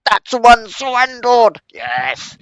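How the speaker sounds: high, loud triumphant cry; excited. Real, not acted. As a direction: cheer the win. Enemy surrenders.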